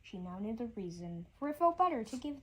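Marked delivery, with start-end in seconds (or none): none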